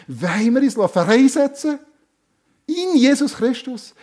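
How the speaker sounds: fervent preacher's rallying call; rousing appeal. Real, not acted. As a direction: lift the congregation with a passionate cry.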